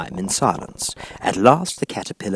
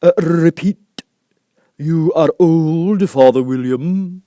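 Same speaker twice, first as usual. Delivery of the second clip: loud, very slow command; caterpillar; 9 semitones higher, 10 dB louder, much slower than usual. A narrator's reading. Caterpillar orders Alice to recite, loud and slow. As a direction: drawl the order heavily. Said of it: "said the caterpillar".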